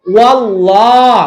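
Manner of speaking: ওয়াল্লাহ slow with a thick, dark double L; recited ওয়াল্লাহ; heavy L in wallah. Shown as a demonstration. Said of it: "ওয়ার জবর help করেছে".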